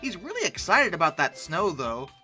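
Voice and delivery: normal talking voice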